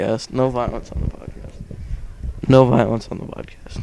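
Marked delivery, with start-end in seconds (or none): 0.3-2.5 quiet plea. Real, not acted. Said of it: "I'm gonna keep repeating this".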